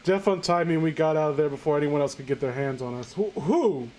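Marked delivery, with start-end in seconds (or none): none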